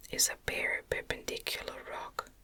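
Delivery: usual speaking voice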